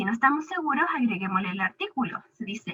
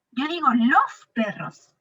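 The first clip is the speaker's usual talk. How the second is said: "los" leaned on, falling; teacher confirming "los perros"; stress on los. Shown as a demonstration. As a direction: land firmly on "los" in "Yo digo los perros".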